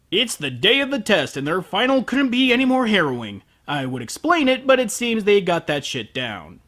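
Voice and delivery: normal talking voice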